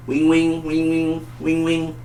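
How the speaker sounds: high, even ring-ring noise; sing-song mock ringtone; imitated phone ringing.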